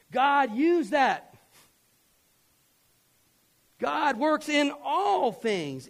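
loud, high, drawn-out proclamation; ringing sermon climax; emphatic preaching. Real, not acted. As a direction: preach it out slowly at full force.

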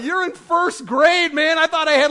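high, loud comic protest; exasperated disbelief. Retold or imitated. Dad shocked by his first-grader's crush, comically exasperated. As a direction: drag out the words in mock dismay.

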